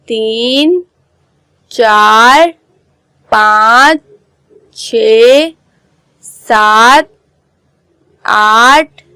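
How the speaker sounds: each number said alone with a rising end; counting recitation. Shown as a demonstration. Teacher reciting numbers again so pupils remember. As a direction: recite the numbers one by one for the class. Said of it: "एक दफ़ा और पढ़ लेती हूँ".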